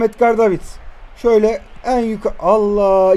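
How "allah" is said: ordinary delivery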